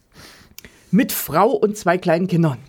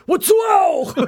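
mock shout; 11 semitones higher than usual. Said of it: "Und er dann".

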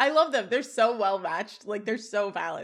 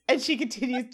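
usual speaking voice

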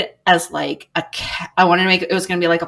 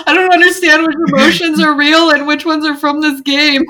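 higher, louder half-shouted confusion; mock panicked player; 11 semitones higher, 8 dB louder than usual. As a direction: play a gamer losing grip on reality. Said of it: "You'd be like".

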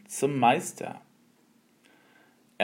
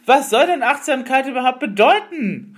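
much higher, loud angry shout; furious outburst at the master; 12 semitones higher, 9 dB louder than usual. Acted. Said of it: "wurde wütend".

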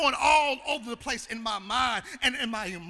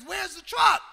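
sharp loud shout, pitched up; father's shout; 7 semitones higher, 9 dB louder than usual. Retold or imitated. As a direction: bellow it like a startled father. Father yelling for missing truck.

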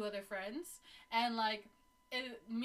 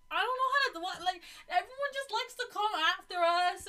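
high, louder, laughing protest; flustered laughing reply; 7 semitones higher, 6 dB louder than usual. Retold or imitated. Retelling her own answer to friends.

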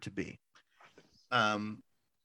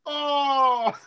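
crowd gasp; 5 dB louder than usual. Retold or imitated. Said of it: "the whole crowd's like".

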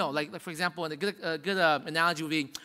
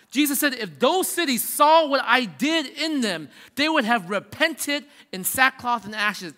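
raised, slow, fervent preaching; preaching at full force; 5 semitones higher, 6 dB louder, slower than usual. Acted. Pastor thunders Jesus' warning to the congregation, preaching at full force. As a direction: drive the warning home to the congregation.

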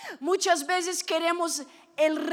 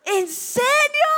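high, drawn-out amazed question; thrilled disbelief; 8 semitones higher, slower than usual. Retold or imitated. Girl promised hairless legs by mom, thrilled disbelief. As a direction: gasp it out, imitating a wide-eyed girl.

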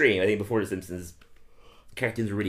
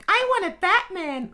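put-on much higher silly kid voice; higher, dim child voice; Ralph impression. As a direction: do a dopey little boy. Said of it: "I don't think I can do Ralph today. Let's give it a go".

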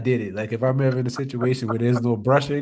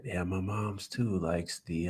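ordinary delivery

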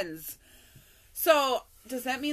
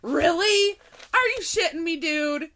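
higher and louder, can't believe it; incredulous disbelief; 7 semitones higher, 7 dB louder than usual. Real, not acted. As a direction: throw it out like you're stunned it's him. Disbelief at who texted.

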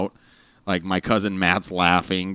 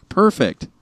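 excited praise; 12 semitones higher, 5 dB louder than usual. Real, not acted. Praising child with headphones.

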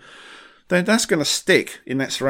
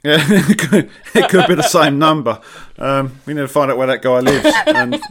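laughing; 4 semitones higher, 9 dB louder than usual. Real, not acted. Laughing about same asker.

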